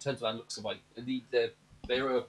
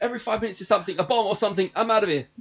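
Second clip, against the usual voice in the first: high, loud exasperated rant; Ruby; 9 semitones higher, 9 dB louder than usual. Retold or imitated. Fleeing character fed up with bombs, mock panicked shouting. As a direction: shriek the complaint in mock panic.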